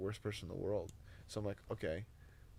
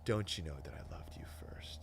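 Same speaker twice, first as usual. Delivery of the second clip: hushed, fatherly whisper; whispered reply; 4 semitones lower than usual. Retold or imitated. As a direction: voice the answer as a tender whisper. Jesus answers him, voiced tenderly.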